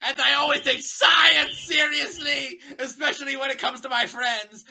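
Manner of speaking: high, loud, slowed declaration; earnest shout. Acted. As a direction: proclaim it with wounded pride. Twilight insisting on science.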